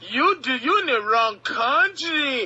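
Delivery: put-on a light Eastern Bloc accent, drawn out; hint of a Soviet accent mocking the heckler. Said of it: "second is that you".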